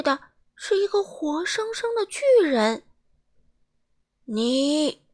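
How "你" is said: loud thundering giant voice; booming giant. A narrator's reading. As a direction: boom it out like a giant. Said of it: "巨人声如惊雷".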